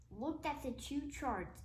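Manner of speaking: ordinary delivery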